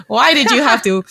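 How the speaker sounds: mock irritated whine. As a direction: uncle grumbling about his nephew imprinting, lightly acted exasperation. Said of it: "very irritated that he".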